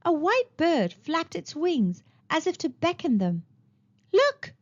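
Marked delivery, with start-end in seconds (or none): 4.1-4.5 high excited call; excited cry. Acted. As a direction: shout it out, eager and bright. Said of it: "cried Hansel".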